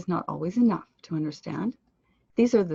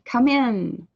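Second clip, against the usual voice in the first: IN louder and higher than COME; stress on IN; 5 semitones higher, 6 dB louder than usual. Shown as a demonstration. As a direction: push the phrasal verb's IN loud. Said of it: "The second part loud".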